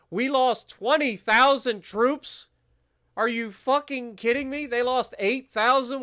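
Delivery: can't believe the losses, shout it; outraged disbelief. Real, not acted. Streamer reacting to lost troops, loud outraged disbelief.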